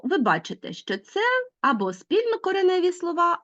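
ordinary talk

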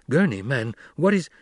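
rising alarmed question; startled, cut off. Acted. Paul alarmed by scene.

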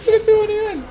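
high, loud burst breaking into laughter; real laughing outburst; laughing excitement. Real, not acted. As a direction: exclaim it, cracking up. Amused excitement at levelling up.

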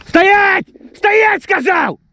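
high, slow shouted order; furious shout. Real, not acted. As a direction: bellow stop at the dog. Stopping the running dog.

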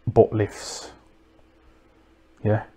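ordinary talk

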